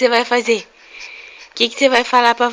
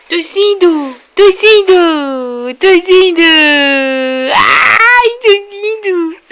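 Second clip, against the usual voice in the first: laughing excitement; 4 semitones higher, 5 dB louder, slower than usual. Real, not acted. Mother delighted by dad's cough.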